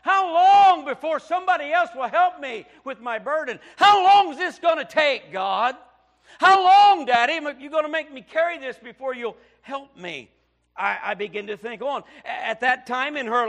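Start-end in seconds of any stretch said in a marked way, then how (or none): 0.0-10.3 loud, high, pleading preacher's cry; Job's anguished cry. Acted. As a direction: cry out as Job, begging God. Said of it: "Job asked".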